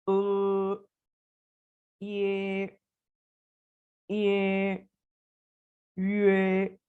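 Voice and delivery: each sound said alone and held, pause between; drilled finals. Shown as a demonstration. Teacher drilling Pinyin sounds for students, held syllables. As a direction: recite the Pinyin sounds slowly, one by one. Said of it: "reciting Pinyin finals drill".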